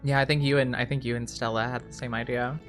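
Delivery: ordinary talk, nothing marked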